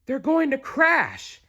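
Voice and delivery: very high, slow alarmed gasp; breathless alarm for friends. Acted. Stella Luna watching her friends fall, high gasp. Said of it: "gasped Stella Luna".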